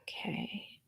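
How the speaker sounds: breathy whisper, very soft; whispered okay. Real, not acted. Host pausing mid-story, whispering to herself. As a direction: breathe it out to yourself. Aside during long pause.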